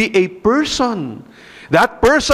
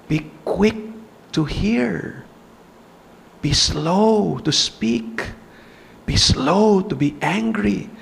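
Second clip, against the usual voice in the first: slow emphatic counsel; 4 semitones lower, slower than usual. Real, not acted. Preaching James's counsel slowly.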